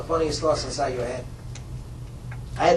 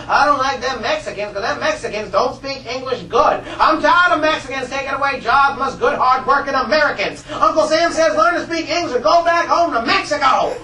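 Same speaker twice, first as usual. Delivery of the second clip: higher, louder mocking bigot, laughing through it; 7 semitones higher, 6 dB louder than usual. A classmate jeers at Mexicans in a higher, laughing bigot voice. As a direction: ham up a jeering classmate.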